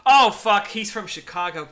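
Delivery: loud, high outburst mid-thought; sudden delighted shock. Real, not acted. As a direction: break off and blurt it out. Sudden discovery about the actor.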